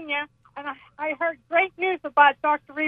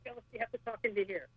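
phone held away; 4 semitones lower, 18 dB quieter, much faster than usual. Real, not acted. Caller speaks away, passing phone.

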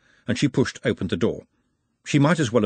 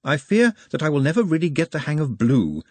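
higher, musing candle-maker; as if to the air; 7 semitones higher than usual. A narrator's reading. Nutt broods over his coloured candles, absently musing. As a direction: muse aloud to nobody in particular. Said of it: "as if to the air".

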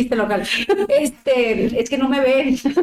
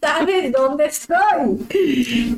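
higher, very slow, drawn-out "¿Sabes dónde estoy?"; exasperated retort; 5 semitones higher, much slower than usual. Retold or imitated. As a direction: stretch every word of "¿Sabes dónde estoy?" in mock exasperation. Mocking husband's phone check. (réplica exasperada, muy lenta y aguda)

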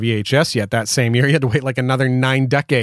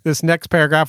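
ordinary talk

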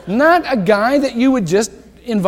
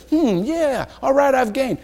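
smug satisfaction; 7 semitones higher than usual. Pretend. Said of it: "I don't want you to say".

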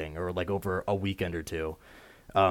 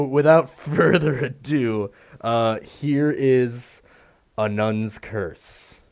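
dramatic announcement; 5 semitones higher, 5 dB louder, slower than usual. Acted. Dramatic intro of the first review.

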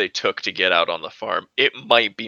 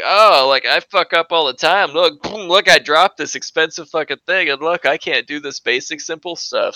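high, louder self-mocking voice; pitched up, showing off clumsiness; mock friend; 6 semitones higher, 6 dB louder than usual. Host quoting the friend's fake confession, high and louder. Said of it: "she's just like".

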